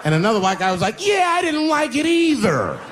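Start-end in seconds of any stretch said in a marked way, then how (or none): 1.0-2.8 much higher, slow, timid; squeaky mock follower; meek agreer. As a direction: tag along meekly.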